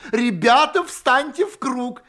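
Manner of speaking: put-on high, loud, merry call to children; cheerful shout. A narrator's reading. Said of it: "кричит весёлым голосом".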